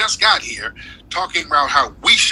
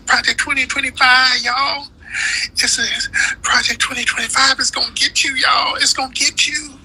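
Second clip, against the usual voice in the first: mock scare-monger; 12 semitones higher than usual. Mocks Democrats' scare talk.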